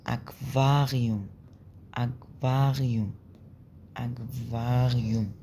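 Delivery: أكفاريوم slowly, every syllable clear, the r sounded; pronunciation demo. Shown as a demonstration. Teacher repeating a German word for students: slow careful demo. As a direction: spell out أكفاريوم slowly, r pronounced. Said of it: "ليه نطقنا الآر هنا".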